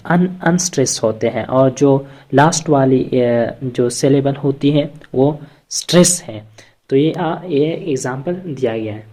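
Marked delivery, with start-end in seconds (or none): none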